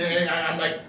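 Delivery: ordinary talk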